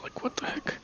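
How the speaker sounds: a breathy puzzled whisper. Real, not acted. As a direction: whisper it to yourself, baffled. Puzzled muttering, then repeated aloud.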